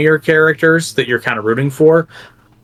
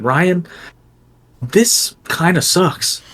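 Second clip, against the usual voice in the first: blunt verdict; 6 semitones higher, much slower than usual. Retold or imitated. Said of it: "pulled him aside and said".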